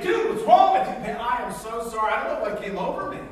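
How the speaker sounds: high, loud cry of protest; punched friend shouting in shock; hurt outburst. Acted. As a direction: yell it like you just got punched. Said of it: "It's like".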